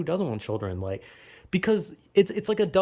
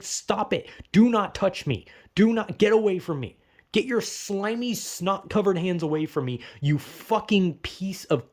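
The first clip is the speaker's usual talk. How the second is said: angry rant; 2 semitones higher than usual. Retold or imitated. Vlogger ranting at an imagined child who touches him. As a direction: snap at the kid to get away.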